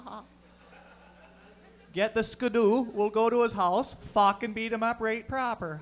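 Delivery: high, dopey Barrie bully voice with goofy laughs; high mocking Barrie hockey bully voice; Barrie hockey bro accent. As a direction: sneer like a small-town Ontario jock. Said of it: "That's a Barrie accent".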